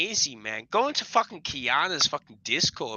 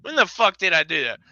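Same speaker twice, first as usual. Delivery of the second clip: high, loud incredulous yelp; 7 semitones higher, 6 dB louder than usual. Retold or imitated. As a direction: sound baffled and shocked. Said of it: "I'm like".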